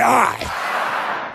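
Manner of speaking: cued shout answer. Shown as a demonstration.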